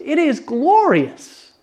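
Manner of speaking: slow and high, savouring glorious; excited. Real, not acted. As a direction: lift it up in real wonder. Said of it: "I'm getting probably too excited here".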